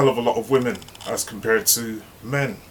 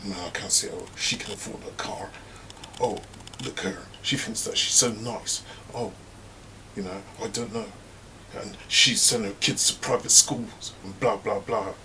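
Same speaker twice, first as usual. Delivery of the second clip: bitching behind back; 6 dB quieter than usual. Retold or imitated. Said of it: "she started bitching".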